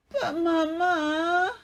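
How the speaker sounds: high, drawn-out whine near tears; slow tearful whine; whining. A narrator's reading. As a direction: whine it like a crying child. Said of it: "she whines".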